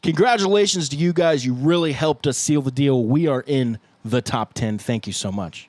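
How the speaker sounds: slow, emphatic announcement, easing into thanks; announced grateful take. Pretend. Bandmate recording the top-ten take for fans, congratulatory announcement. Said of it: "let's do two takes".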